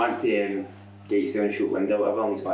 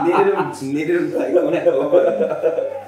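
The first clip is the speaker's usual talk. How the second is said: half-laughing the words out, louder; 7 semitones higher, 9 dB louder than usual. Real, not acted. Storyteller cracking up at his own story, laughing. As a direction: still giggling through the punchline.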